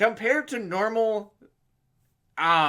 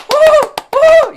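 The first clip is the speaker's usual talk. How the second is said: much higher, loud mocking whoops; mock cheering; 17 dB louder than usual. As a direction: whoop like a fawning crowd, sarcastically. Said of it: "the same deal I just did".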